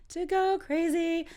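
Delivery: high, slow playful repeat. Shown as a demonstration. Teacher repeats the next phrase for learners, playfully high. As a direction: lift "To go crazy" way up and stretch it.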